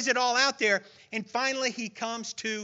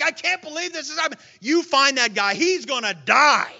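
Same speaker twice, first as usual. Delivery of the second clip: rising outrage, louder to the end; indignant king; 5 semitones higher, 7 dB louder than usual. Retold or imitated. David outraged at the rich man, building angry demand. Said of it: "He's like".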